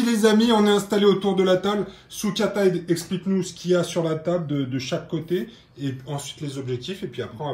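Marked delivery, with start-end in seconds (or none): none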